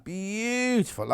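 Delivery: Beautiful stretched slow and savoured; drawn-out beautiful. Shown as a demonstration. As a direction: linger on Beautiful, slowly and softly. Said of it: "admiring the fabrics again".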